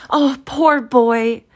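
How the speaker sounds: high, loud, drawn-out outcry; between laughing and crying. A narrator's reading. Scrooge cries out over his younger self, overwrought. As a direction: wail it out slowly in overwrought excitement. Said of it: "most extraordinary voice between laughing and crying".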